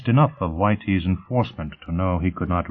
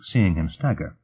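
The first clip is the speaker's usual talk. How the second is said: usual speaking voice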